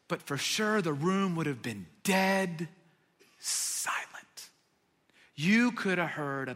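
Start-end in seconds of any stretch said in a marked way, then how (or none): 3.4-4.5 whispered. Shown as a demonstration. Describing the dead silent room.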